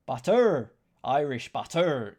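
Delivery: loud mock Irish shout of butter!; Irish accent. To the chat, a loud hammed-up Irish accent. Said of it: "Don't do the accents".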